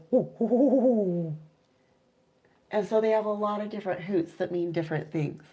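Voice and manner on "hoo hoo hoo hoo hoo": gentle hooting like an owl on her shoulder; soft owl hoot. Shown as a demonstration. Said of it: "it's just a soft one".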